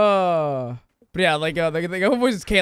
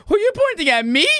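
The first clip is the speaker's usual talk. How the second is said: mock indignant squeak; 12 semitones higher, 6 dB louder than usual. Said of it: "And she's just like".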